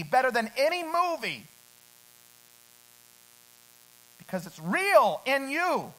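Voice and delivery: loud, high, fervent sermon delivery; full-force preaching. Acted. Preacher to his congregation, loud fervent preaching. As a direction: preach it out to the whole room.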